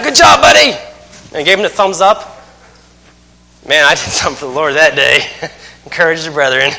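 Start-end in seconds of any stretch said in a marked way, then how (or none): 0.0-0.7 loud, much higher cheering shout; cheery yell. Retold or imitated. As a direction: call out encouragement across the course. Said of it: "I yelled at that guy".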